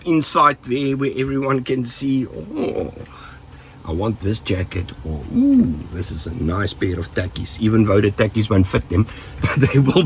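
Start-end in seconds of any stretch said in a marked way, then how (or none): 2.3-7.6 a light, hushed voice for a greedy thief; mock thief. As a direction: toss off a thief's whispered wish, half joking. Imitating a thief eyeing valuables.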